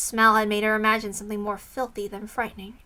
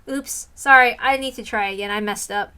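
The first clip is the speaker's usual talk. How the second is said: usual speaking voice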